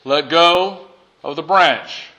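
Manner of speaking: slow, even divine instruction; slow command. Acted. God tells the hanging man to let go, slowly. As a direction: say it slowly, calm and sure.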